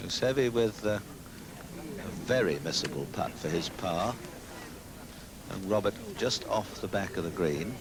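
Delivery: hushed live golf commentary; golf commentator. Real, not acted.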